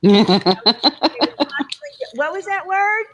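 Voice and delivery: high, loud teasing question; mocking girls. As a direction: imitate girls pouncing on an odd word. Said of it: "used to make so much fun of me".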